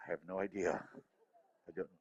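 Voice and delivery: a small voice admitting it is clueless; lost inner voice. Retold or imitated. The parent's inner voice confessing, low and soft. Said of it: "Other little voice".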